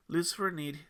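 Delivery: usual speaking voice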